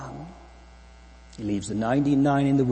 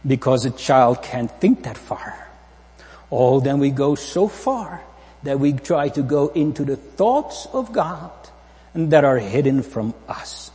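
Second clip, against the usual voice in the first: louder, driving preacher's voice; preaching at full force; 4 semitones higher, 6 dB louder than usual. Acted. Preacher warning the congregation about pride, in full-force preaching. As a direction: build it up to the pulpit.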